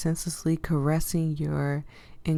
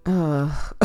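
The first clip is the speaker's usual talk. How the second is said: ordinary talk, nothing marked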